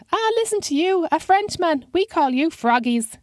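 put-on very high, teasing old man voice; high-pitched old man. A narrator's reading. Old man mocking a Frenchman's accent, high-pitched voice. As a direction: needle him in a high old man's pipe. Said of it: "with a high-pitched voice".

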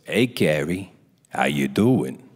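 deep, knowing imitated greeting; mock director's casual low drawl; Rob Reiner. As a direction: drop low for a loaded hello. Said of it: "Just like that".

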